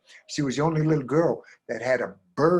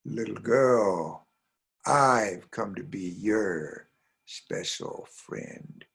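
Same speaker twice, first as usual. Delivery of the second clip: very slow, drawn-out, lower voice; mysterious stranger; 3 semitones lower, much slower than usual. Acted. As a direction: lure her with long, slow words. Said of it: "And the person said".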